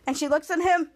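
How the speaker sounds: normal talking voice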